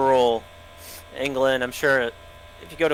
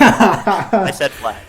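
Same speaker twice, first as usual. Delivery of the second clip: slow, high, laughing through it; laughing drawn out; 7 semitones higher, 10 dB louder, much slower than usual. Real, not acted. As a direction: stretch it out while cracking up.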